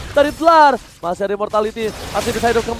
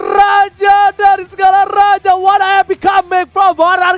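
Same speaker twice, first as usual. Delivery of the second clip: shouted victory call; fighting game announcer; 5 semitones higher, 5 dB louder, slower than usual. Real, not acted. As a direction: roar it like a champion. Caster shouts comeback.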